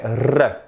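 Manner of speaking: "R" as a long rolled trill; Spanish R. Shown as a demonstration. Teacher shows the Spanish sound, "R" trilled. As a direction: roll the "R" Spanish-style. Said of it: "le R espagnol".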